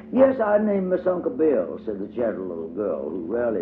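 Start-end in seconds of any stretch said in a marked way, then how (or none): 0.1-1.8 put-on small and careful like the youngest child; tiny child voice for Tot. A narrator's reading.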